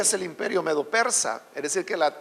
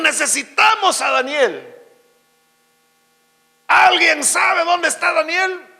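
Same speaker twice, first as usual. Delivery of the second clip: loud, slow, raised-pitch summons; calling out; 6 semitones higher, 4 dB louder, slower than usual. Acted. Royal servants searching for forgotten Daniel, calling out loudly. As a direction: project it like a search call through the palace. Palace voices call out for Daniel.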